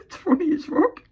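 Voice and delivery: high, laughing through the words; giggling high. Real, not acted. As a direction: laugh it out, amused. Laughing at rivals.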